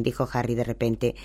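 usual speaking voice

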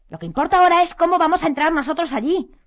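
high, loud insistence; bellowing. A narrator's reading. Harry urges his friends on, bellowing. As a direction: push the point home loudly. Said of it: "bramó él".

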